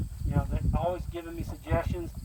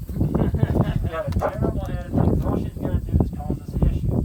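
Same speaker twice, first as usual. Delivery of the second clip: firm and louder, ending the argument; firm retort; 3 semitones higher, 7 dB louder than usual. Retold or imitated. To his wife about the heifer, firm and louder.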